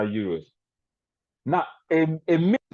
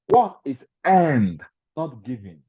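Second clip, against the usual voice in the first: slow emphatic; 4 dB louder, slower than usual. Acted. Slow punchline of sermon point.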